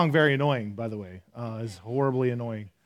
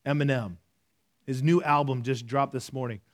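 usual speaking voice

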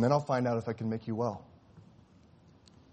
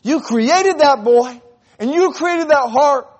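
slow, much higher and louder accusation; angry outcry; 18 dB louder, slower than usual. Acted. Surgeon keeps shouting his anger at God. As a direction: cry it out in rage. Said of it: "his anger was hotter".

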